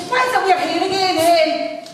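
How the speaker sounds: loud, high Coatbridge woman; Coatbridge accent. Retold or imitated. Caller giving her name to reception, loud Coatbridge accent. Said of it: "in her best Coatbridge accent".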